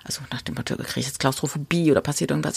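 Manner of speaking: hushed, anxious inner voice; half-whispered claustrophobia fear; worried whisper. Retold or imitated. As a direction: whisper the worry under your breath.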